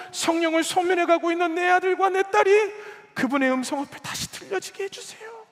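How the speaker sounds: prayer building to a high, loud cry; full-force, escalating intercession; impassioned crying out. Acted. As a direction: cry out to God with mounting urgency. Same plea escalating to a cry.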